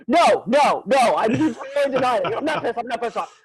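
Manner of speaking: loud, high, protesting too much; hammed-up indignant protest; imitated heated denial. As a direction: act out an insistent denial. Client hotly denies being angry.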